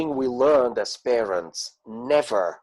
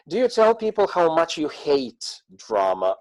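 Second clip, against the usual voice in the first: normal talking voice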